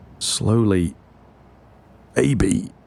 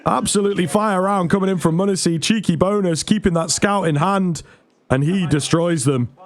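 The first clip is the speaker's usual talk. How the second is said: much higher, screaming the ace; excited; 10 semitones higher than usual. Real, not acted. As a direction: lose it over the ace. Ace round, caster screaming.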